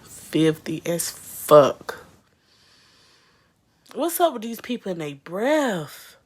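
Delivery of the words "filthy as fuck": very slow, loud, drawn-out filthy as fuck; loud slow disgusted repeat; slow emphatic echo. Real, not acted. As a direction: drag each word out in disgust. Echoing emphatically.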